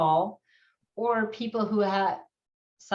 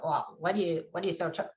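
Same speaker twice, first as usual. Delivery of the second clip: softer, lower, questioning herself; quiet inner voice doubting the tears; self-questioning; 5 semitones lower, 6 dB quieter, much faster than usual. Retold or imitated. Speaker quoting her own inner voice, quiet and lower. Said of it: "I even hear myself".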